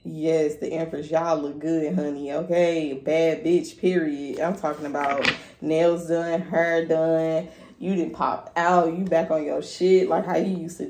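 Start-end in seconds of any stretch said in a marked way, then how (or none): none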